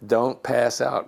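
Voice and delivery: slow, dazed inner plea to himself; slow self-urging. Retold or imitated. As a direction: drag out don't pass out, half-stunned. Repeats the thought to himself.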